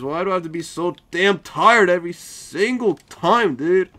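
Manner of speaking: loud, whiny complaint about being tired; exasperated yell. Real, not acted. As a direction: groan it out, fed up.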